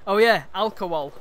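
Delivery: loud northern accent with dropped H; exclaimed northern dialect word. Shown as a demonstration. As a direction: say "alcohol" in broad northern English. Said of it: "the way they drop the H".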